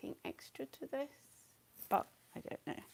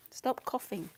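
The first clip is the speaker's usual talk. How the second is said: ordinary talk, nothing marked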